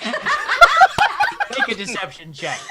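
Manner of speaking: high, loud helpless laughter; real cracking up while speaking; laughing hard. Real, not acted. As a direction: say it through a fit of laughter. GM cracks up at player's lie.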